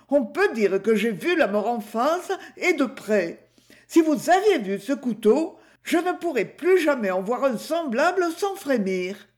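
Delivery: lightly voiced shopkeeper hamming up her terror; dramatic butcher's wife. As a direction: play up the horror for the journalists.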